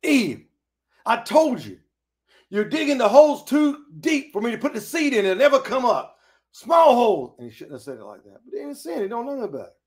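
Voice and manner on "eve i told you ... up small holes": mildly angry Adam. Pretend. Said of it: "maybe he got angry".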